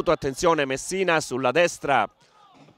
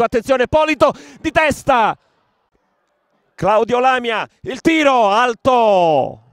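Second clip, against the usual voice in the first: excited commentary; 9 semitones higher, 8 dB louder than usual. Real, not acted. Chance called live.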